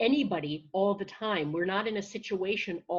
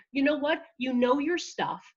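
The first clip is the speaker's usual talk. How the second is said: higher, encouraging pep talk; lifted, upbeat voice of encouragement; supportive friend; 6 semitones higher than usual. Acted. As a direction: reassure a nervous friend with conviction. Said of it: "You would instead say something like".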